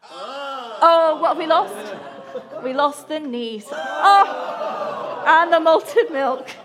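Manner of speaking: high, loud shouts through laughter; excited laughing shouts. Real, not acted. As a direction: call out the fallen biscuits, laughing. Biscuits collapse in dunking contest.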